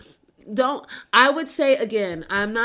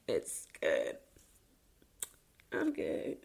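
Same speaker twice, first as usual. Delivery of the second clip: barely audible, tearful whisper; pulling it together after tears; choked up; 12 dB quieter, slower than usual. Real, not acted. Collecting herself after crying over mom.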